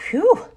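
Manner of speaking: high, loud breath of relief; relieved whew. Acted. Katy narrowly escaping the guard, relieved whew. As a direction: blow out a big whew of relief.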